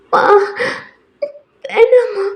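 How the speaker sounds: high wailing refusal; acted sick girl crying out to her mother. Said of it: "புள்ள அழுகுறாடி".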